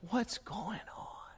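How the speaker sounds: soft, slow, tender ask; gentle question. Acted. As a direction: ask it softly like a caring father. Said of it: "And God says".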